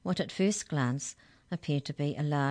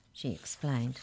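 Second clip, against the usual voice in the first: usual speaking voice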